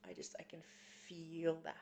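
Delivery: soft, breathless, half-whispered, close to crying; holding back tears while speaking softly; near tears. Real, not acted. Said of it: "it's like, I wanna cry".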